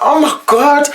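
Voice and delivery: high, loud shocked oh my God. Retold or imitated. Hearing the driver wants to marry, shocked oh my God. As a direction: gasp it out in disbelief. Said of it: "I was like".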